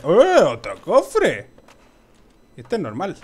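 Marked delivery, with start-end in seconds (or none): 0.0-1.5 excited. Real, not acted. Finds another chest in game.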